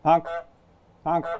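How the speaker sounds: short sharp goose honks. Pretend. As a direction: honk like a goose. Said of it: "he's also gonna honk".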